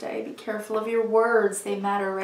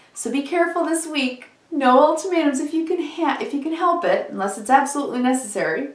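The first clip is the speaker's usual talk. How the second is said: higher, louder, urgent caution; emphatic warning; 5 semitones higher, 5 dB louder than usual. Real, not acted. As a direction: warn them firmly. Warning viewers about ultimatums.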